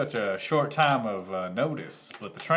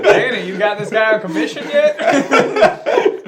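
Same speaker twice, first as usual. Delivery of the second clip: laughing; 8 semitones higher, 8 dB louder than usual. Real, not acted. Laughing at the drunk bit.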